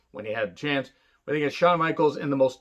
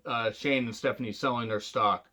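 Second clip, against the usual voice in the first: usual speaking voice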